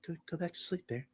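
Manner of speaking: soft, high hushed coaxing; eerie soft imitation; imitating creepy Nikki. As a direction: coax softly in a high, small voice. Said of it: "And she goes".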